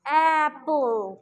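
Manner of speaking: एप्पल stretched very slowly; slow teaching demo of एप्पल; drawn-out demo. Shown as a demonstration. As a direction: draw out एप्पल slowly for the class. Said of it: "mind में क्या चीज़ लाओगे".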